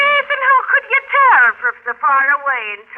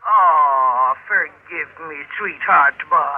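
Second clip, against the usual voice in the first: tender apology; 7 semitones lower, much slower than usual. Acted. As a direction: coo an apology to him. Meg softens into apology.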